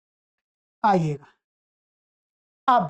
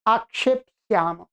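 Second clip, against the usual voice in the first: ordinary delivery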